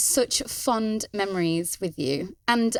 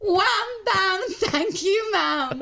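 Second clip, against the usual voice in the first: high, laughing catchphrase; 9 semitones higher, much slower than usual. Real, not acted. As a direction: squeal it through giggles. Repeating guest's catchphrase laughing.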